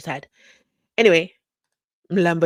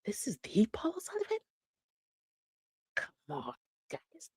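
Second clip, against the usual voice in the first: hushed, breathy question of disbelief; whispered disbelief; 11 dB quieter than usual. Real, not acted. Host watching the clip with viewers, whispering in disbelief. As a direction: whisper it like you can't believe your eyes.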